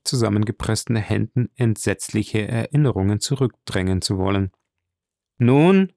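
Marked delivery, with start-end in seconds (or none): 5.4-5.9 high, loud prompting question; raised-voice, impatient prompt; calling out. A narrator's reading. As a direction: call it out sharply, rising high. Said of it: "rief der Graf".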